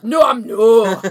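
loud Scottish shout; Scottish accent. Shown as a demonstration. Demonstrating the key phrase to friends, shouted Scottish accent. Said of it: "in a Scottish accent".

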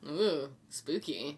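slow low mock spooky. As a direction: draw it out eerily.

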